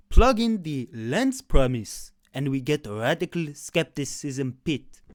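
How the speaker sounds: slow, loud, mock-grand announcement; mock pompous. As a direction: spell out the formula like a ridiculous recipe, slowly. Parodying the argument's formula.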